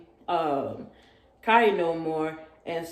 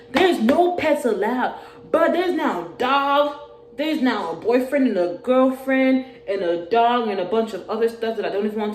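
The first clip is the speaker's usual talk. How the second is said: exasperated rant; 5 semitones higher, 6 dB louder than usual. Real, not acted.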